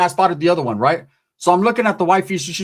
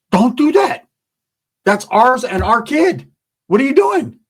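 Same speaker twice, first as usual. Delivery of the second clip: retold yell; 6 semitones higher than usual. Stopping the wife swinging her cane at the girlfriend, a high yell.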